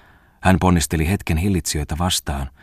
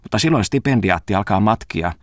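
usual speaking voice

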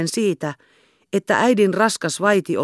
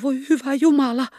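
high, pained cry to God; a frightened girl behind the door; anguished exclamation; 9 semitones higher than usual. A narrator's reading. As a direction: let it escape in anguish. Girl fears for Hannes.

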